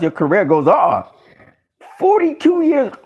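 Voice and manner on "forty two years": much higher, incredulous; astonished high voice; amazed. Real, not acted. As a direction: squeak it out in disbelief. Amazed at Pacquiao's age.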